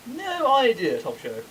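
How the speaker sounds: plummy English gent, lightly done; posh English voice. Pretend. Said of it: "it's just po-, posh English voice".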